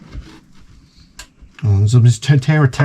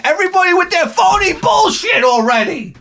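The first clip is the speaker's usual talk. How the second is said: ranting shout. Real, not acted. Comic ranting at phony TikTok users, a high shout. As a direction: blow up at all the phonies.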